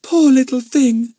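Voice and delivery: high, slow tearful pity; near tears. Acted. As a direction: say it almost crying. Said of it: "almost in tears".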